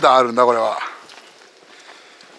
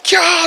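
high, louder "kyaa" scream; retold crowd squeal, pitched way up; imitated fangirl squeal; 11 semitones higher, 5 dB louder, slower than usual. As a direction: mimic a crowd shrieking at the gag. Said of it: "一部では悲鳴とも捉えられてた".

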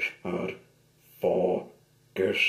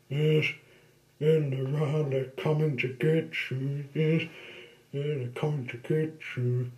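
teasing; 5 semitones higher than usual. Acted. Teasing ghost-story taunt.

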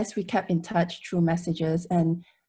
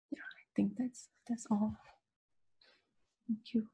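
quiet whisper; 3 semitones higher, 12 dB quieter than usual. Real, not acted. Ending tearful eulogy speech.

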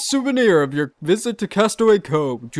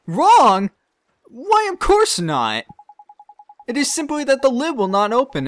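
feigned innocence; 8 semitones higher, 5 dB louder than usual. Acted. Peddler denies anything wrong with the pot, falsely innocent. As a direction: cover the lie with charm.

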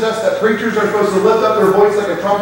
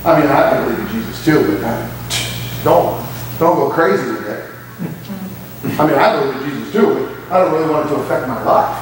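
low, mock-casual shrug of a voice; lower, lazy, dismissive imitation; 9 semitones lower than usual. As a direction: mimic a half-hearted believer, much lower. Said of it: "a whole lot of Christians that wanna follow Jesus from a distance".

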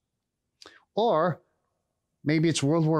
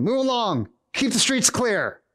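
imitated Roman soldier, pitched up; soldier's command; 7 semitones higher than usual. Soldier standing beside the stable, calling out orders. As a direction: call out higher, ordering people along. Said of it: "maybe there was a soldier".

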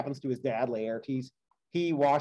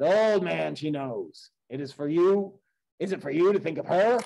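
a hint of curt gruffness; gruff; 4 semitones higher, 6 dB louder than usual. A narrator's reading. Odysseus to the old servant, mildly gruff rebuke. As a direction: brush the old man off, short and firm. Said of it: "Odysseus gruffly said".

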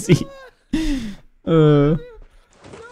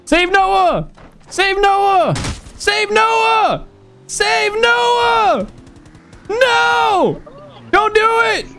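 high shout; much slower than usual. Real, not acted. Begging in game to save Noah.

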